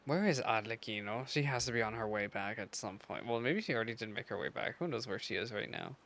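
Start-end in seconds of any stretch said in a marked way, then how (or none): none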